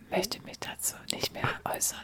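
breathless whisper, pulling back. Real, not acted. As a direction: whisper it as if embarrassed. Declines to comment after joke.

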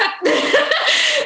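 high-pitched laugh; burst of laughter. Real, not acted. Reacting to a friend's story, high burst of laughter. Amused during the chat.

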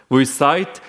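normal talking voice

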